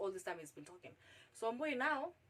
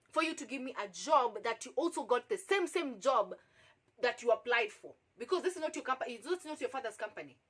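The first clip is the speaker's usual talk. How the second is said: raised, heated confrontation, punching key words; indignant; 3 semitones higher, 6 dB louder than usual. Retold or imitated. Applicant confronting boss who wants sex for job, indignant. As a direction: throw the accusation back in outrage.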